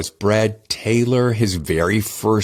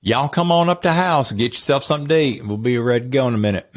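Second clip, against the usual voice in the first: put-on North Carolina accent; 5 semitones higher, faster than usual. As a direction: call folks in for supper in a homey North Carolina accent. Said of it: "that's my upbringing, which is North Carolina".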